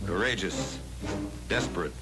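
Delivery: normal talking voice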